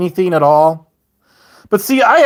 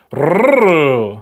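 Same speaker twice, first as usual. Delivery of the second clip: long rolled R, rising then falling; attempted trilled growl. Shown as a demonstration. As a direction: trill it like a failed animal call. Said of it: "that sucked".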